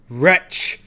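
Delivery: sudden loud outcry; cried out. A narrator's reading. Narrator crying out at the raven, loud. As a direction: hurl the word out in anguish. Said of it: "I cried".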